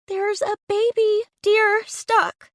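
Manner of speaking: high, loud, slow call from the bushes; aunt calling out. Acted. Aunt stuck in the bushes calling out to the searchers, high and slow.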